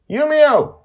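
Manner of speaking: very high, loud call; shout. Acted. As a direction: yell her name across. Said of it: "I shout".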